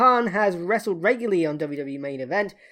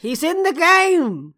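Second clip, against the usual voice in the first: slow, high, mocking insistence; stubborn insistence; 7 semitones higher, 4 dB louder, slower than usual. Real, not acted. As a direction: dig in and troll him.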